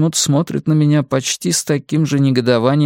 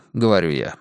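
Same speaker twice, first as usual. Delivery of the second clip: plain reading voice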